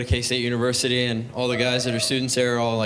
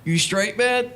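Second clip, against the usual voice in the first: higher-pitched, questioning dealer voice; voiced weed dealer; 9 semitones higher, much slower than usual. Weed dealer to his confused buyer mid-smoke, higher put-on questioning voice. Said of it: "So he'll say shit".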